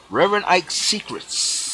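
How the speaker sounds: "Secrets" high, with a long hissed final S; imitated preacher, "Secrets" trailing a sustained sss; Reverend Ike. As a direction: draw out the S ending "Secrets". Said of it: "he would say it like this".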